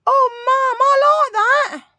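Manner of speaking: very high, loud, drawn-out daughter impression; mock delighted squeal. Daughter seeing the item Mum bought, imitated delighted shriek. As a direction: screech it like an overexcited daughter.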